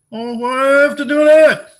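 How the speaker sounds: high, loud whining complaint; loud high whine; whiny reply. Pretend. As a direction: whine it like a put-upon kid.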